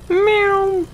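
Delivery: very high squeaky meow; cat meow. Pretend. Gamer goofing around in the plane, high cat meow.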